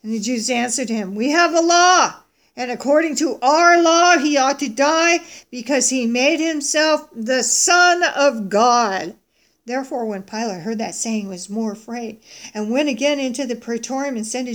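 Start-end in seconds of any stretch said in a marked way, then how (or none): 1.1-9.1 loud, slow, raised-pitch accusation; insistent protest. Acted. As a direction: hammer the charge home forcefully. Crowd argues back at Pilate.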